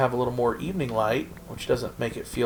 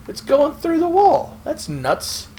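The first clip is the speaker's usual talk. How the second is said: surprised; 4 dB louder, slower than usual. Real, not acted. Glitchy tree through wall.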